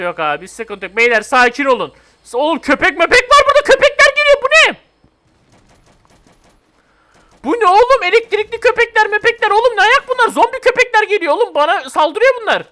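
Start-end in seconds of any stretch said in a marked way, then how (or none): 2.2-12.6 high, loud panicked shouting; real panic. Real, not acted. Gamer shouting at sudden zombie dogs, panicked high yell. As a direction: scream it as the dogs close in.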